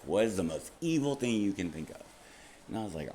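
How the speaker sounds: usual speaking voice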